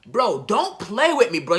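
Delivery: half-shouted denial of the news; loud, slow, stunned refusal; shocked disbelief. Retold or imitated. Repeats denial to brother.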